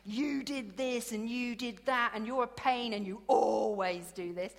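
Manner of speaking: retold spouses' row, lightly hammed-up; mock row. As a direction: imitate a couple slinging blame. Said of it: "lash each other and slash each other".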